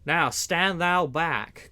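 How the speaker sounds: slow, loud warning shout; angry cry. A narrator's reading. Man-at-arms shoves back at Little John, loud and slow. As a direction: shove the words back at him, slowly. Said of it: "cried one of the men-at-arms".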